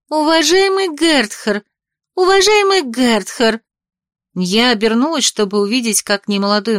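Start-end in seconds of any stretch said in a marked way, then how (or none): 0.1-1.6 high, slow calling out to him; ringing girlish call. A narrator's reading. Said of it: "звенящий девичий голос". 2.1-3.6 higher, slower insistent call; ringing girlish call. A narrator's reading. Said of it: "звенящий девичий голос".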